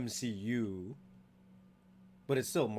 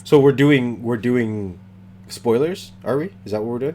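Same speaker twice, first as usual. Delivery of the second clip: loud, teasing mock outrage; mock indignant; 15 dB louder than usual. Real, not acted. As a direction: call him out playfully. Co-host teasing about spoilers.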